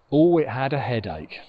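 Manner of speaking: usual speaking voice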